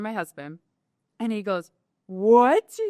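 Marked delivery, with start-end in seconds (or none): 2.1-2.8 loud, rising cry of disbelief; retold incredulous outburst; shocked. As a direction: react as a stunned husband, raised voice. Husband's retold shock at the shorts.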